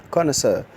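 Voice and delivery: connoisseur said KON-uh-SUR, stress on the last syllable; teacher saying connoisseur with a final SUR; pronounced connoisseur. Shown as a demonstration. Pronunciation teacher modelling connoisseur for students. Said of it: "The word is pronounced as connoisseur".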